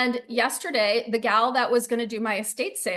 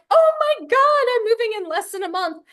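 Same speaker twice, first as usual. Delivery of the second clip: shocked exclamation; 9 semitones higher, 4 dB louder than usual. Retold or imitated. Retold panicked reply.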